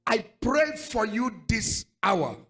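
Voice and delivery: loud, slow prayer over the crowd; fervent prayer. Acted. Pastor prays over the congregation, loud and slow. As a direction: pray it out with full force.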